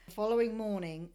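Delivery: ordinary talk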